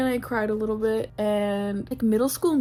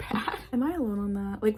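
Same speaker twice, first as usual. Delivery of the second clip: normal talking voice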